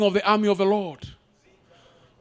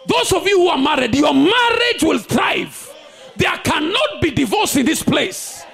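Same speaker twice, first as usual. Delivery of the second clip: shouted, high prophetic preaching; fiery declaration; 7 semitones higher than usual. Acted. Preacher declaring blessings over the congregation. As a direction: declare it over the crowd with full force.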